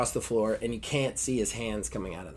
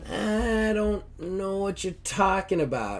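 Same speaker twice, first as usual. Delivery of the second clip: nervous denial; 8 semitones higher than usual. Retold or imitated. Blade nervously denies.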